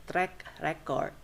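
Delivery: track record with record as REC-ord; first-syllable stress. Shown as a demonstration. Teacher giving a noun example, track REC-ord. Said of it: "that is a noun".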